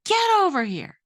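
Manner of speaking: high, loud sudden summons; mother yelling. Retold or imitated. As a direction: bark the order like an angry mom calling. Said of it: "just yell".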